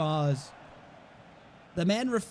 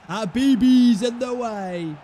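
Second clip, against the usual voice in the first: loud, slow groan at the screen; frustrated groan; 3 semitones higher, 9 dB louder, slower than usual. Real, not acted. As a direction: drag it out, exasperated and loud.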